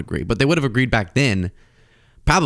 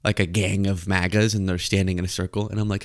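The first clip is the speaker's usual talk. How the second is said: ordinary talk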